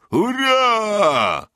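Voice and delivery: high, held charging cry; battle shout. A narrator's reading. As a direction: shout it as you storm the guns. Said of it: "krzyknął Bartek". (Bartek krzyczy, biegnąc na armaty)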